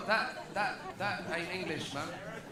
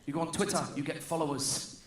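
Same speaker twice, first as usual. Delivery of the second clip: ordinary delivery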